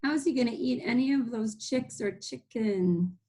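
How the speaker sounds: ordinary delivery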